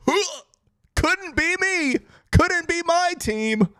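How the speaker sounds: high, slow, teasing; smug gloat. Real, not acted. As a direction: savor the rival's failure. Gloating over rival's bad quarter.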